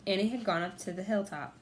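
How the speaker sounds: usual speaking voice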